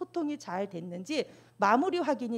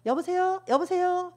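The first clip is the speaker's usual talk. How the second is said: high, loud hello into a bad phone line; calling on a bad line; 9 semitones higher, 7 dB louder than usual. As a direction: call out to check the line. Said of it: "전화가 잘 안 될 때". (잘 안 들리는 전화에 높고 크게 여보세요)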